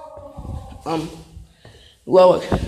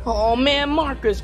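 ordinary delivery

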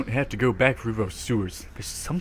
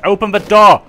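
loud, higher shout; frantic yell; 9 semitones higher, 13 dB louder than usual. Real, not acted. Gamer under attack yelling at Rebecca to open, loud shout. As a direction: yell it in a panic.

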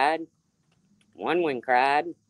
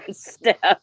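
laughing; 3 semitones higher, 5 dB louder than usual. Real, not acted. Laughing while naming viewer.

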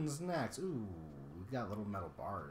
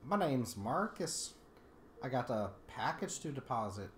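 normal talking voice

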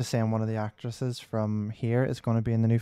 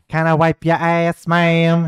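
slow, louder mock-polite carer voice; South Park quote; 4 semitones higher, 8 dB louder, much slower than usual. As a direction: ham up the fussy helper, drawn out. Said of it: "It's just like".

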